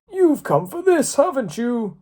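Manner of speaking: put-on a high, wise old man offering a book; third old man. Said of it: "said the third man".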